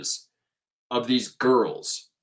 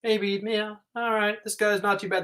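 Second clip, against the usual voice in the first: lightly voiced dog, higher and grudging; voicing the dogs; 9 semitones higher than usual. Barking dogs deciding the photographer is fine, lightly voiced higher. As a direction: give the calmed dogs a reluctant higher voice. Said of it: "Four of the dogs are like".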